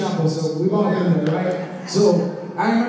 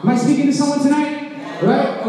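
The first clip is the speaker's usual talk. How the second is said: raised, ringing preacher voice; preaching at full force; 4 semitones higher, 5 dB louder than usual. Acted. Pastor urging the congregation, full preaching voice. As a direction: preach it out to the whole room.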